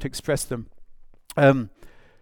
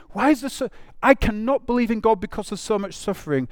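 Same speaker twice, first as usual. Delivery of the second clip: high-pitched, anguished plea, acted; crying out; 8 semitones higher, 4 dB louder than usual. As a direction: play someone crying out, pitch lifted. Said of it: "somebody who's crying out and saying".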